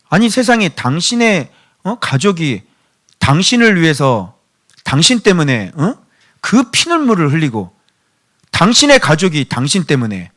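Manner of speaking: normal talking voice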